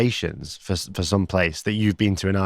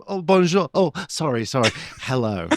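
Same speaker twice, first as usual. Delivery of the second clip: imitating Adam's feigned mix-up; 6 semitones higher than usual. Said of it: "that kind of feigned thing where you confuse it".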